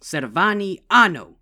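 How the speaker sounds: loud, slow, solemn command; commanding incantation. A narrator's reading. Big sister ends the ritual with a loud solemn call. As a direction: call out the final word of the spell.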